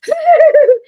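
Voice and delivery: high, loud shriek imitating a startled man; wordless hoot shooting way up in pitch; imitated holler. Imitating John finding a wolf spider in the tent, high holler.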